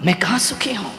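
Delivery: soft, dropped-away complaint; quiet lament. Acted. Swan softly laments to the saint about his life. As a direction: sigh it out almost to himself.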